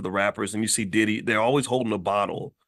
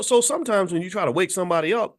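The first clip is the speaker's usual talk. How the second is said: usual speaking voice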